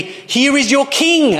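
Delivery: high, slow mock announcement. Pilate presenting Jesus to the crowd, mocking. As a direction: sneer it out as a mock hail. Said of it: "he says mockingly".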